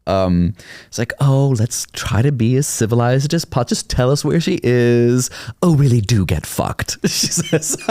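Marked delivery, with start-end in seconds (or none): 1.2-7.0 sweetly smiling insult. Retold or imitated. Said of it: "like, with a smile".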